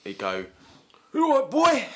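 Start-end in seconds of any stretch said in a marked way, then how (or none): 1.1-1.9 hammed-up bouncer, high and loud; strained comic bruiser challenge; Lenny McLean. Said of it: "say he was a bouncer outside a nightclub".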